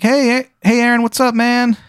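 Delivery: very high, chill little fly; tiny high bug voice. Acted. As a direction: squeak it like a laid-back insect. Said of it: "a voice be like".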